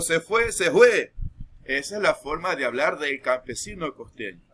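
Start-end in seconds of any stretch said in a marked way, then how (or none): 0.0-1.1 "se fue" with the F swapped for a J sound, loud and high; coastal peasant "se fue". Shown as a demonstration. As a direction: call out "se fue" the way a coastal farmer says it.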